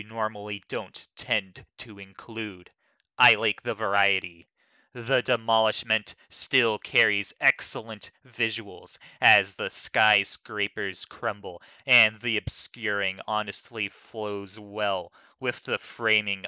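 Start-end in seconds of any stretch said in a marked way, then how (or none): none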